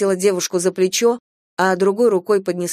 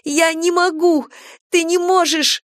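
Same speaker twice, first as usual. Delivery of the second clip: gasping; 10 semitones higher, 4 dB louder than usual. A narrator's reading. Girl sobbing, breathless.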